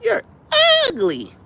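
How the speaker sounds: high-pitched mocking call; say it just like that. Shown as a demonstration. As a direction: squeal it high, then let it drop. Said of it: "But just say it like that".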